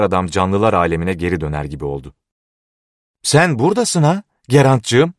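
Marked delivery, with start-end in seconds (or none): 3.2-5.1 put-on much higher, excited old man's cry; raised, high call to a loved one. A narrator's reading. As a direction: exclaim in high-pitched recognition. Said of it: "diye bağırdı".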